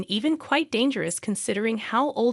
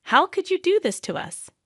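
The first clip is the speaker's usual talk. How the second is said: high-pitched, outraged demand, only lightly raised in volume; mild shout; 9 semitones higher than usual. A narrator's reading. Mother-in-law confronting daughter-in-law over the phone, mild high-pitched shout. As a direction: voice leaps up in pitch, understated shout. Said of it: "before shouting in my ear".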